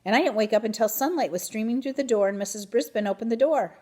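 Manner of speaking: usual speaking voice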